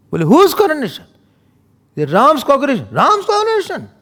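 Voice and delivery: high, loud eager announcing; excited news. Acted. Servant bursts out with Ram's coronation, high and loud. Acted dialogue of Manthara.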